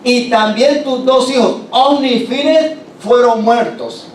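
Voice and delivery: más agudo y lento, dando la peor noticia; noticia del mensajero. Acted. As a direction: remata la muerte de los hijos despacio. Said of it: "le da la noticia al viejito". (el mensajero le dice a Elí que murieron sus hijos, lento)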